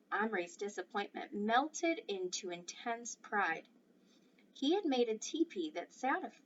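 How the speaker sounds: usual speaking voice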